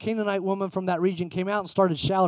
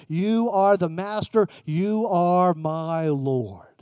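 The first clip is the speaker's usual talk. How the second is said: slow, devoted confession; 3 dB louder, slower than usual. Acted. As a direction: draw out "You are my Lord" very slowly. Woman's confession, drawn out.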